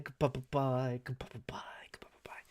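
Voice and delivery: a sign-off to viewers fading from whisper to silence. Shown as a demonstration. Repeated goodbyes, fading out.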